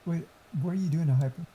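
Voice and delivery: usual speaking voice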